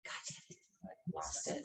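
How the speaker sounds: under-the-breath whispered curse; muttered curse. Real, not acted. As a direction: whisper it to yourself, annoyed at losing the thread. Loses her train of thought.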